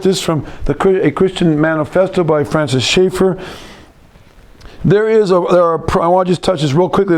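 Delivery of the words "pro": normal talking voice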